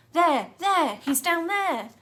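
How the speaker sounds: loud high excited shout; police shouting. A narrator's reading. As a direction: point and yell. Police spotting him below.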